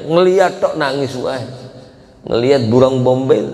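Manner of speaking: ordinary delivery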